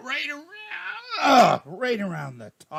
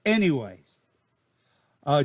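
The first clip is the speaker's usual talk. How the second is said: ordinary delivery, nothing marked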